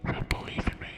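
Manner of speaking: hushed, voiceless whisper; ASMR whisper. Acted. Streamer closing the viewer's affirmation, in a whisper.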